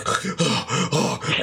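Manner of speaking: heavy, laboured panting; winded gasping. Acted. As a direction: gasp for air like the straggler at the back. Acts out straggler trudging in mud.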